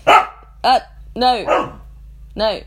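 loud irritated refusal at the dog; irritated snap. Real, not acted. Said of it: "see what kind of a mood I'm in".

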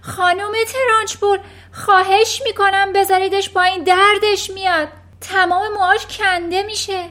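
high, loud desperate plea; pleading cry. Acted. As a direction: beg her to stop, calling across the room. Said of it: "فریاد زد". (التماس بلند و نگران از ته کلاس)

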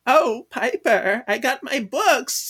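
lightly voiced nerd; nerdy girl. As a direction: play the proud bookworm.

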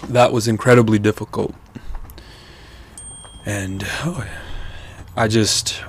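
breathless pauses between words; panting, struggling to finish; out of breath. Real, not acted. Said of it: "I'm out of breath".

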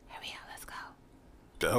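hushed urgent whisper; breathy, slow, unvoiced hurry.

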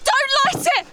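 high, urgent alarm, rising to a cry; panicked warning. Acted. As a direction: stop him before he lights the torch. Realises the creature is a basilisk.

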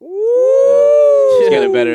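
mock falsetto announcement, drawn out slowly; sarcastic high cry. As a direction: sing it out high and slow in mock praise. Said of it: "He's cut-, he's cutting a wrestling promo".

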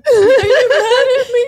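high, slow wail with laughter; mock tearful. Crying daughter fearing a parent's anger, high hammed-up wail. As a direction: whine it loudly as if crying.

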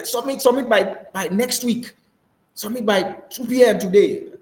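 high, loud mock order; demanding boss. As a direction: play a boss pressing a deadline. Acted boss setting a deadline.

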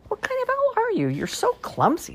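high, exasperated wolf scolding; Wolf fed up with clumsy owl, high scold; upset. Acted.

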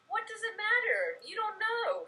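mock exasperation, pitch pushed well up; exasperated retort. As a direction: argue back in a high, laughing voice. Retells arguing back, exasperated.

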